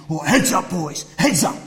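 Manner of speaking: rally the crew like a boat cox; cox calling out. Retold or imitated. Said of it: "they're calling out".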